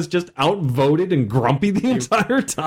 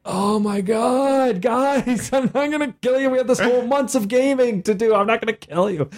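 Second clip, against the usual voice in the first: exasperated pleading; 5 semitones higher than usual. Retold or imitated. GM pleading with cautious players.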